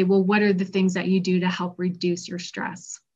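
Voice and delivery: ordinary talk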